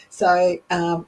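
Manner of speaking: usual speaking voice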